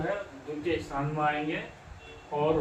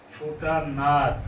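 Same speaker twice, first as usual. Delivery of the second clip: drawn-out answer; 4 dB louder, much slower than usual. Shown as a demonstration. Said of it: "ये क्या हो गया हमारा?".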